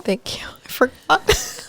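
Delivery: soft whispered aside; whispering. Real, not acted. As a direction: breathe it as a sheepish whisper. Whispered aside while laughing.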